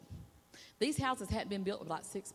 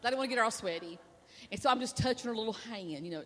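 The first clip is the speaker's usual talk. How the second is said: normal talking voice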